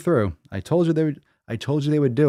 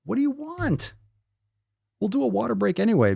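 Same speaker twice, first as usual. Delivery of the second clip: pet talk; 11 semitones higher than usual. Real, not acted. Asked of the pet.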